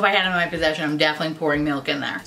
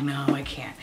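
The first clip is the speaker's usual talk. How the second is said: low, soft self-denial; reluctant refusal; 6 semitones lower, 8 dB quieter than usual. Retold or imitated. Vlogger resisting gorgeous packaging, reluctant quiet refusal. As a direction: turn it down quietly, lowering your voice.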